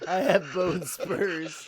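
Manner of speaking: mock Trump voice.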